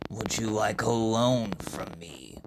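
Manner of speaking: low correction. Acted. Iridis flatly correcting Usuke.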